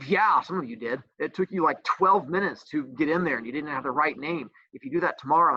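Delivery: normal talking voice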